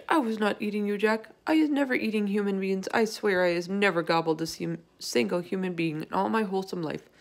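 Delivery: plain reading voice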